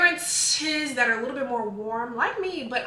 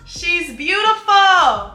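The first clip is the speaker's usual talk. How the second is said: slow, loud and high, drawn out with delight; gushing; 7 semitones higher, 13 dB louder, much slower than usual. Real, not acted. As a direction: swoon over her out loud. Gushing over new perfume.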